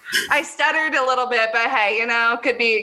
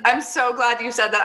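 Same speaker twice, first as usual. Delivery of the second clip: normal talking voice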